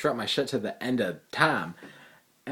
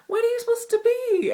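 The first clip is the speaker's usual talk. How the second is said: much higher, curious hall-monitor voice; 3 dB louder than usual. Retold or imitated. Hall monitor asking about the costume, very high voice. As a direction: ask it high and puzzled. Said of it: "She goes".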